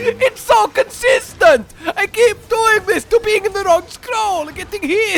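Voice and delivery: loud, high laughing lament; frustrated shout. Real, not acted. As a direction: wail about the mistake, half laughing. Frustrated outburst at own mistake.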